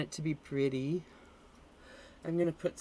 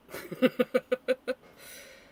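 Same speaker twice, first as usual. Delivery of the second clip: bright laugh; 10 semitones higher, 8 dB louder than usual. Real, not acted. Laughs after joking about friend.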